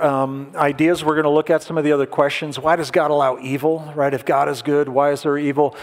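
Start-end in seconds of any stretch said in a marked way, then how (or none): none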